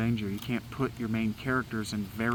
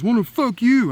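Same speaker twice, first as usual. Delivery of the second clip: high, loud mocking squeal of her line; mocking her comeback; 12 semitones higher, 11 dB louder than usual. Mocking the woman who cursed him, high and loud. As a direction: parrot her back in a shrill-pitched sneer. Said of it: "she turns around and goes".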